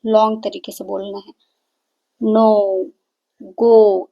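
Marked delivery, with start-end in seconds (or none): 2.2-4.1 नो and गो with a long o, louder; long vowel demo. Shown as a demonstration. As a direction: stretch the o in नो and गो, projecting to the class. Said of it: "इस तरीके से".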